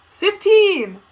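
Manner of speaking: shouted high and loud; loud delighted announcement; excited shout. Real, not acted. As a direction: blurt out the result, thrilled. Reveals quiz result.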